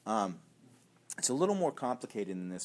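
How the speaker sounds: ordinary talk, nothing marked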